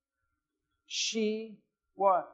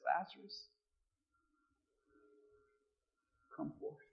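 hushed command; 12 dB quieter than usual. Acted. Preacher as Jesus calling Lazarus from the grave, hushed command. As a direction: drop to a near-whisper on the last words. Jesus quietly calls the dead man.

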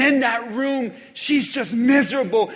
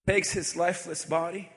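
zachte verteltoon preek; 7 semitones lower, 10 dB quieter than usual. Acted. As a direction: draw them in softly with the story. Quiet retelling of grieving mother.

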